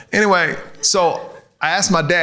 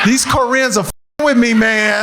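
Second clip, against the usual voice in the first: mock anger; 6 semitones higher than usual. Real, not acted. Mock outrage at Korean audience.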